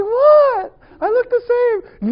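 high, loud, wide-eyed protest; mock woman's voice. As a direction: play her as a startled woman insisting nothing changed. Woman voiced in a retold story.

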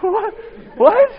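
very high-pitched, startled questions; baffled husband, voice shooting up; mock bewilderment. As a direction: squeak it out, completely taken aback. His startled reply to the scream.

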